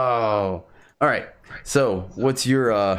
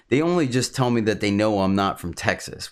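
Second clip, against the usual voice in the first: ordinary talk, nothing marked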